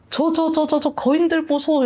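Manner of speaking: high, stammering alarm; alarmed knight. Retold or imitated. Don Quixote pointing out giants to Sancho, high stammering alarm. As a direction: stutter at imaginary giants.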